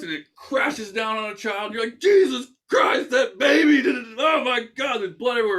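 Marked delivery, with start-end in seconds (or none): 2.0-5.6 much higher, louder horrified shriek; mock panic. Bystander watching horse crush child, hammed-up horrified shriek. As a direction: scream it in hammed-up horror.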